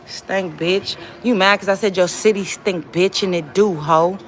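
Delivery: ordinary talk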